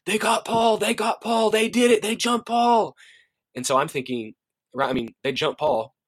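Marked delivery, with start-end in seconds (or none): 0.0-3.0 high, frantic imitated kid's scream. Middle school kid bursting in the front door, screaming the news. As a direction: cry out the alarm like a panicked kid, pitched way up. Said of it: "ripping through the front door screaming".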